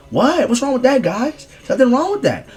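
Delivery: high, innocent little-girl imitation; hammed-up high schoolgirl; mock girl voice. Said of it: "She's like".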